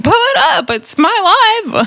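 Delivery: high, stretched-out mock dare; mock defiance. Recapper mimicking the reply about the live, high mock defiance.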